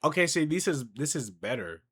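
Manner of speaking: ordinary talk, nothing marked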